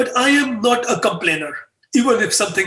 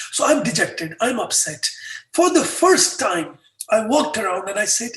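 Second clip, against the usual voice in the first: ordinary talk, nothing marked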